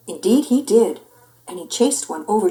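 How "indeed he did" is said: usual speaking voice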